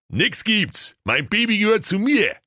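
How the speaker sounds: high-pitched possessive suitor's cry; Käserudi calling out. A narrator's reading. Warding off a rival for the Lambada, high possessive cry. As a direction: call out jealously, pitched well up. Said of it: "so rief der Käserudi".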